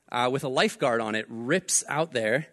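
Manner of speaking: usual speaking voice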